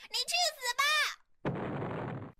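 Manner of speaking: 音调猛然拔高、拖长的怒吼; 扯着尖高嗓子把话慢慢吼出来; 火冒三丈地大叫. A narrator's reading. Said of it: "火冒三丈的大叫". (暴怒尖叫，拖长尾音)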